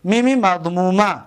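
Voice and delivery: ordinary talk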